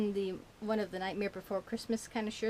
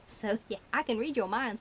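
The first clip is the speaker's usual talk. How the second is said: usual speaking voice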